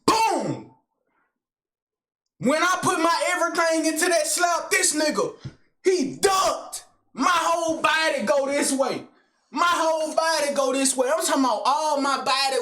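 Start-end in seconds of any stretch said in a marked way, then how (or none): 0.0-0.7 imitated slap boom. Acting out the big slap. 2.4-10.1 climbing into a shout at the punchline; excited retelling. Retold or imitated. Telling friends how his big slap missed, rising to a shout.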